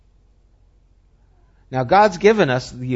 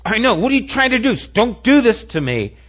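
pushed up much higher and louder, a retold defiant protest; defiant outburst; 8 semitones higher, 4 dB louder, faster than usual. Student pushing back against his professor, retold defiant protest. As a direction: throw it back at him, defensive. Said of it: "He gets defiant".

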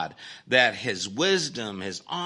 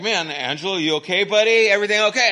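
loud, high worried calling out; alarmed call; 9 semitones higher, 9 dB louder, faster than usual. Retold or imitated. Roommates calling to sleepwalking Angelo, loud and alarmed. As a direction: call out to a friend who's lost it.

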